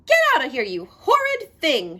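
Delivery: loud, high angry outburst; furious cry. A narrator's reading. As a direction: chase him out at full volume. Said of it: "she cried".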